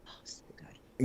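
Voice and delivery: breathless whispered awe. Real, not acted. Reacting to shield theme.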